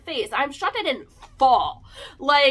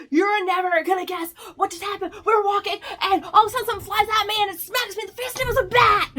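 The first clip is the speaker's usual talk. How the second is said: higher, louder rushed excited telling; high breathless excitement; excited retelling; 8 semitones higher, 3 dB louder, faster than usual. Retold or imitated. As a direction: gush the news to a friend. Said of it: "I was like".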